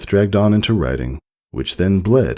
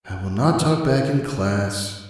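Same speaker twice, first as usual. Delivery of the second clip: bored lines; 5 semitones higher, 3 dB quieter than usual. Acted. Writing lines in detention.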